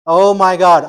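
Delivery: loud, startled oh my God; loud dismayed cry to the students; loud exclaim. Real, not acted. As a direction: exclaim in disbelief at the class. Teacher exclaims at weak answer.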